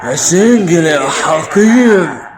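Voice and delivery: loud, drawn-out indignant cry; shouted insult. Acted. Son and father bickering over a play, loud indignant shout. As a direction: hurl it out at full voice, stretching the words.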